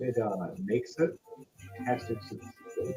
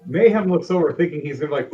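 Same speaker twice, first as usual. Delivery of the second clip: ordinary talk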